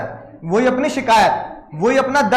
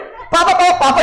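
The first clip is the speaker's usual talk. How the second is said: laughing pleading; 6 semitones higher than usual. Acted. Kid pleading while dad slaps.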